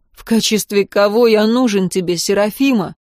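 plain reading voice

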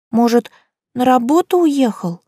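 put-on high, sly child's voice; a mischievous little girl. A narrator's reading.

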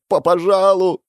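high, stammered tearful plea; tearful near-shout. A narrator's reading. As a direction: beg loudly through tears. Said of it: "Едва не кричал парень в слезах".

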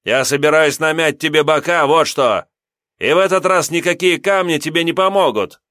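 loud, high bully threat. Acted. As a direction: shout the threat at him. (громкая угроза задиры)

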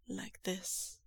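soft seductive whisper. Acted. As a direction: lean in and breathe it. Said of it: "Lan Zhan whispers".

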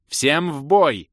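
протяжный высокий командный крик; рявкни приказ, растягивая слова; рявкающая команда. A narrator's reading. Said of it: "рявкнула баронесса". (боевой клич командирши)

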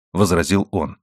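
usual speaking voice

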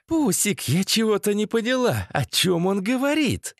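put-on high, girlish, questioning voice; puzzled girlfriend. A narrator's reading. Masha asking Pusik what he means, high puzzled voice.

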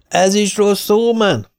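high, slow shout over the blaring cassette; shouting over music. Retold or imitated. Shouting into Gyarmati's ear over the Bee Gees, slow and high. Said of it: "ordítottam Gyarmati fülébe".

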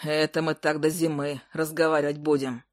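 usual speaking voice

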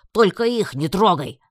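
loud, high, desperate demand; yelling. A narrator's reading. Heroine begs emperor to spare friends, yelling. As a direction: shout to protect your friends. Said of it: "заорала я во всю глотку".

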